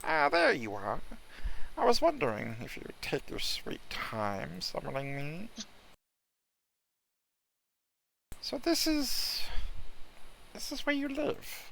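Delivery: high, languid cat voice; snooty bored cat. Acted. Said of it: "do the cat voice".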